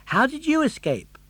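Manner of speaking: put-on high, lively puppet boy; bright young puppet voice, eager; Pinocchio voice. Pinocchio asks.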